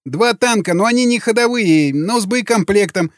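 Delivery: high, whining prisoner voice; frightened confession. A narrator's reading. Prisoner at knifepoint explains, frightened. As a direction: plead and inform in a high, scared pitch.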